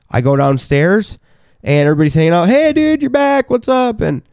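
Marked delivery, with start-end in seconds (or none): 2.5-4.0 high-pitched partygoers welcoming a buddy; excited greeting imitation. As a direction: imitate cheery guests, voice pitched up. Party guests greeting him back.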